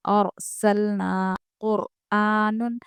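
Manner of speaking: slow recitation of और सलना कुरआनुन, every ra full and heavy. Shown as a demonstration. Teacher reciting a Quran word slowly for students, heavy ra. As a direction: stretch out और सलना कुरआनुन with a thick ra. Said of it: "आप ऐसे बारीक नहीं पढ़ेंगे".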